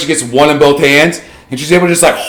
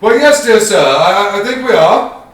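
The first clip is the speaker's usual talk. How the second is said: lightly voiced polite passenger, a bit higher; polite carriage passenger; 6 semitones higher than usual. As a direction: answer sweetly from inside the carriage.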